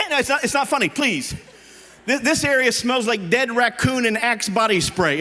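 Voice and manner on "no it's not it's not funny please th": ordinary talk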